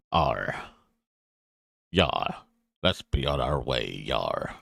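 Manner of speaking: low growling pirate voice, arr and yar; pirate talk. Acted. Player hams up a pirate voice for Lokar. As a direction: ham it up like a stage pirate. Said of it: "Why are you talking like that? Um, isn't that how pirates talk?".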